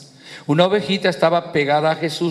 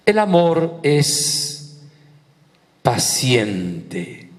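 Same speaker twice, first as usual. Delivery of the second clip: slow, weighted scripture; much slower than usual. Acted. Preacher delivering the key line on patience, slow and weighted. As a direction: draw out each word, then let the last one sink.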